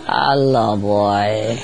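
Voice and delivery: low, drawn-out hello; parrot talking in dream. Retold or imitated. Parrot talks in a dream near the dogs, low voice. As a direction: drop deep and stretch it out. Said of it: "they talk in a dream".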